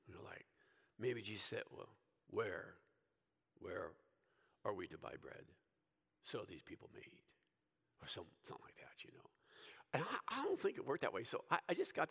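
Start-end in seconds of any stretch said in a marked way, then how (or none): 1.7-7.2 hammed-up holy voice of Jesus; mock God inflection. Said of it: "kind of a God inflection to 'em". 8.0-9.3 quick throwaway whisper; much softer, fast whispered tag-on; whispered aside. Real, not acted. Aside after the mock voice.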